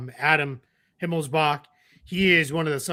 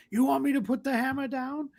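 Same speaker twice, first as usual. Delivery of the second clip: high, softer put-on movie quote; Thor; 9 semitones higher, 5 dB quieter than usual. Answering someone who asks him to put something down, hero impression. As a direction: imitate the hero's mock question. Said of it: "Anytime somebody's like".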